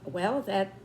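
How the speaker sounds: ordinary delivery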